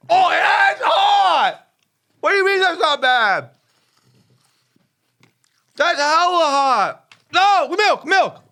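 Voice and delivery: high yelps of real pain; shouting in pain. Real, not acted. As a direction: yell as the heat hits. Just bit a very hot pepper.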